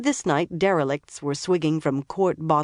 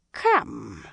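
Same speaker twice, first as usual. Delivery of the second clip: slurred; 5 semitones lower than usual. A narrator's reading. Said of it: "he slurred".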